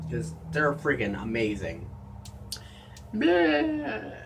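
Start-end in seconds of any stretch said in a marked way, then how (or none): 3.1-4.1 a sudden high squeaky bleh of disgust; high gross-out noise. Real, not acted. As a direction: spit out a squeaky yuck. Disgusted at the drink's taste.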